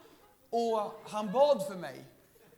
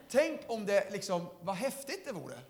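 ordinary talk

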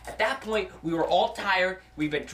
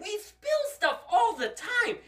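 put-on childish protest; 13 semitones higher, slower than usual. Kids brushing off mom's worry.

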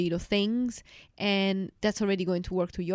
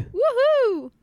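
high, loud cheering whoop; excited whoop; 7 dB louder than usual. Pretend. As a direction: whoop like a rodeo crowd. Segment cheer.

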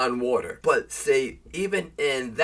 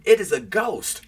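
raised, louder scripture outcry, mildly alarmed; fearful outcry; 7 semitones higher, 5 dB louder than usual. A narrator's reading. Disciples seeing Jesus on the sea, fearful outcry. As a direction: read it as a mild cry of alarm. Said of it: "they cried out for fear".

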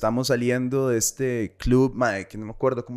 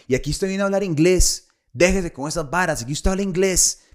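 higher-pitched imitation of an angry local; raised, insistent mock reprimand; mock irate scolding; 5 semitones higher, 3 dB louder than usual. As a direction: act out a hammed-up telling-off. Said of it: "Pero así, mae, así".

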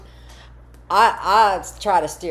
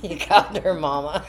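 laughing. Real, not acted. Friend laughs at story.

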